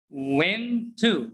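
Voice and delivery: "went to" as two separate words; full "too" vowel in "went to"; careful, over-separate "went to"; unreduced wrong example. Shown as a demonstration. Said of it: "they don't pronounce it like that".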